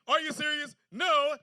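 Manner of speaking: loud "Are you serious?" then punchy "No."; right call and answer. Shown as a demonstration. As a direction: demo the right crowd callback, loud.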